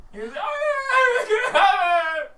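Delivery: very slow, very high loud wail; drawn-out wail. Acted. Beaten thug wailing slowly and very high. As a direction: moan it out long and high.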